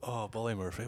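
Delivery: ordinary talk, nothing marked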